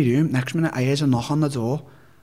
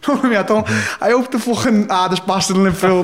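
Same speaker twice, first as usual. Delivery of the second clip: laughing while talking, higher; 7 semitones higher, 4 dB louder than usual. Real, not acted. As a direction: chuckle through the words. Laughs at own story.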